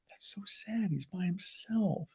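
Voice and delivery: soft, slow whispered pity. Retold or imitated. Family pitying the solo diner, whispering softly. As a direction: whisper it like a sorry onlooker. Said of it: "this family looking at me like".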